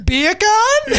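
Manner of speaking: high, giggly disbelief; laughing. Retold or imitated. Telling friends about seeing a meme high, giggling. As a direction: laugh it out, delighted. Retelling stoned laughing fit.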